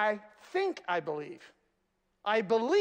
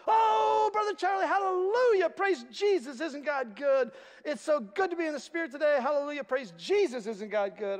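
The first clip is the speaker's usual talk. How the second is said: gushing church lady; 5 semitones higher than usual. Retold or imitated. Pastor playing Sue rushing up to him, high and gushing. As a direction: burst in like an overjoyed believer. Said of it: "And here comes Sue".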